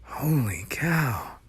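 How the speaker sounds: slow awed whisper. Acted. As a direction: breathe it in awe. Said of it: "whispered Tom".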